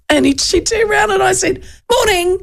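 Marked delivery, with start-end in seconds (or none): 1.9-2.4 bright high chirpy "Morning"; chirpy greeting. Retold or imitated. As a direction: say "Morning" chirpily and cheerfully, pitched up. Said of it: "really chirpily".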